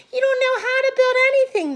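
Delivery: high, loud, fast sneering brothers' voice; mocking brothers. A narrator's reading. Brothers mocking their sister, high taunting voice. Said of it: "they said".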